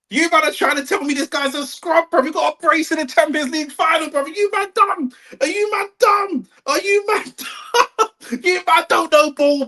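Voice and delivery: high-pitched, exasperated disbelief; incredulous rant. Real, not acted. Streamer berating his chat for calling Doué a scrub, high exasperated rant.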